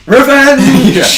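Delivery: loud, high villain shout of revenge; dramatic shout. Retold or imitated. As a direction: bellow it like a brooding antihero. Mocking Shadow's misreading.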